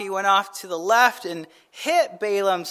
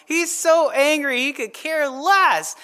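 ordinary talk, nothing marked

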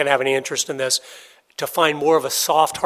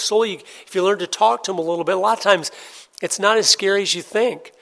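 ordinary delivery

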